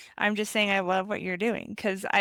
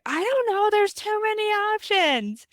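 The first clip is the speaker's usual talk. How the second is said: put-on much higher, louder whining child; whiny kid; 12 semitones higher, 5 dB louder than usual. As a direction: whine like an overwhelmed little boy. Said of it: "my son will just be like".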